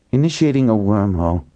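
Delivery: usual speaking voice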